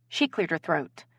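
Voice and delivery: ordinary delivery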